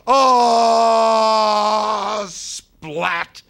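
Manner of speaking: long, high, loud falling cry; falling scream. Acted. Ghost acts out his suicide jump, falling scream. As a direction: scream all the way down, then smack the landing.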